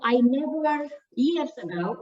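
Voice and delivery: normal talking voice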